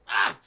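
loud, low mock huff of outrage; affronted gasp. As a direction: let out a short, offended "ah". Old man's affronted reaction.

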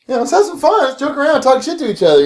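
mock eager. Roommate urging the D&D guys to loosen up, high and eager. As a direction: pitch it up like a party guy. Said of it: "you're like".

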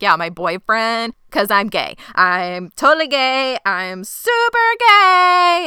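building to a high, loud mock scream; hammed-up shout into the wilderness; screaming declaration. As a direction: escalate to a drawn-out imitated holler. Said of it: "he's just screaming it into the wilderness".

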